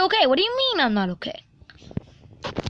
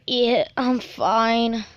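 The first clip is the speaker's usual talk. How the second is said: ordinary talk, nothing marked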